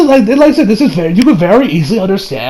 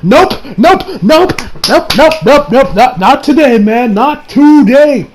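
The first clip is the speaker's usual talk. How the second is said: high, repeated rising nopes, last words drawn out; acted emphatic refusal; 5 semitones higher, slower than usual. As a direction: refuse point-blank, comically insistent.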